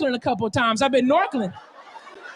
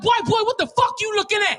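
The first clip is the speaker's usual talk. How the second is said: confrontational woman; 7 semitones higher than usual. Retold or imitated. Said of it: "She like".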